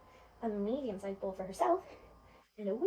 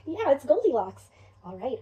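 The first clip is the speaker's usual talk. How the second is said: usual speaking voice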